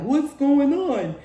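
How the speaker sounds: high, loud mocking whine of a confused player; mock whiny complaint. Promiscuous person wondering why everyone uses them, high mocking whine. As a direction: whine it up high like you can't believe it. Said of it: "And they're like".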